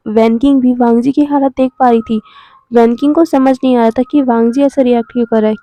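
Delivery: usual speaking voice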